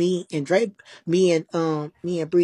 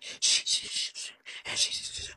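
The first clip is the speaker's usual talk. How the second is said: soft whispered shushing; retold shushing, softer and whispered; hushing; 8 dB quieter than usual. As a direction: shush them under your breath. Hushing people in retold scene.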